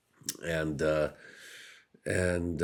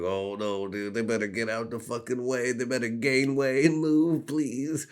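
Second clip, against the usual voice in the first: mock urgent plea; 6 semitones higher than usual. Comedian imitating himself begging people to move. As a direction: beg them to move, rising. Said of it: "I was like".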